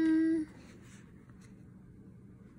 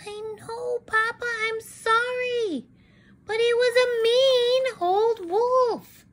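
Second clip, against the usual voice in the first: higher, slow indignant chick; high pleading chicken voice; Little Red Chicken; 9 semitones higher than usual. Acted. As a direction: explain herself like a wronged child. Chicken excuses herself.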